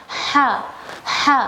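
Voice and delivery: ha breathed from the mid throat; ha with a breathy mid-throat h. Shown as a demonstration. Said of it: "di bagian tengah-tengah tenggorokan".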